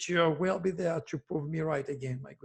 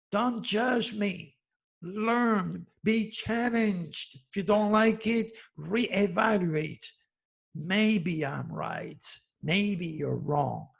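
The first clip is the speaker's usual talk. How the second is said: slow deliberate lecture; slower than usual. Real, not acted. Lecturing critics, word by word.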